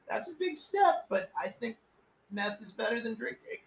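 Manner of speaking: high-pitched, put-on supportive voice; mock-encouraging. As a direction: humour him with squeaky, tentative approval. Retold humouring reply about quitting meth.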